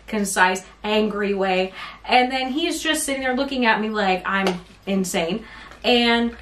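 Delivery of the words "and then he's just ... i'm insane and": ordinary talk, nothing marked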